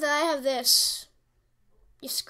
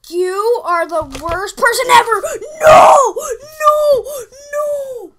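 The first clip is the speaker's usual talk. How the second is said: loud, high anguished no; frustrated yelling; 8 semitones higher, 12 dB louder than usual. Real, not acted. Gamer yelling in despair at his opponent. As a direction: wail at the screen.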